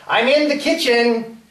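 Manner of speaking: calling out. Acted. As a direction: shout back to another room. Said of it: "I call out".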